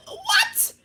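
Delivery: squeaky, laughing mock shock; shocked squeal. Driver hearing of two tickets, squealed mock shock. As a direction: shoot way up into a comic squeak.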